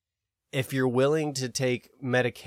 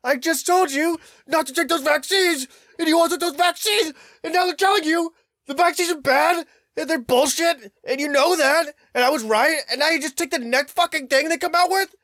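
high, loud, shrill-edged outraged rant; 8 dB louder than usual. Retold or imitated. Ranting at family about the new drug, high and loud. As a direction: rant at the whole world in disbelief. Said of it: "for a moment where I was like".

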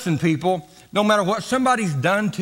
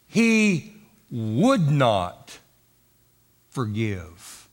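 "would not" drawn out slow and falling; slow stressed would not; 8 semitones lower, much slower than usual. Shown as a demonstration. Preacher to congregation, stressing "would not". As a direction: lean hard on "would not".

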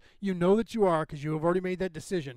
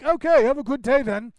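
put-on a light Scottish accent, louder and higher; a lightly voiced Scottish goodbye; imitating a Scottish accent; 6 semitones higher, 8 dB louder than usual. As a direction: toss it off in a breezy Scottish accent. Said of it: "really cool Scottish accent".

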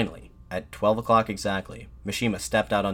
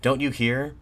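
higher, louder exasperated question; raised retort, read aloud; Mishima shouting back; 6 semitones higher, 5 dB louder, slower than usual. A narrator's reading. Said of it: "Mishima shouted back".

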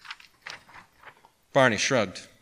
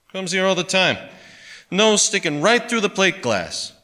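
grumbling pawnbroker; 10 semitones higher, 7 dB louder than usual. A narrator's reading. Pawnbroker complaining about kid.

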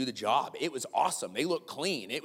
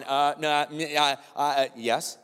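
stammering, caught-out confession; mock-flustered stammer to a reluctant yes; sheepish admission; 5 dB louder, slower than usual. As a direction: hem and haw, then give in. Acted sheepish admission when caught.

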